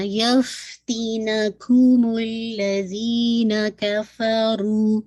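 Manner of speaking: یفتینکم الذین کفروا very slow, full nasal ghunna; slow tajweed recitation. Shown as a demonstration. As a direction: recite slowly with the fullest ghunna. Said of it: "most complete غنہ".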